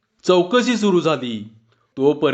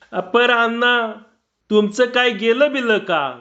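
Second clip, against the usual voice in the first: lightly voiced villager, raised pitch; a higher rustic voice, slowed at first; village man asking; 7 semitones higher than usual. Neighbour asking Anna what was stolen, a lightly raised rustic voice.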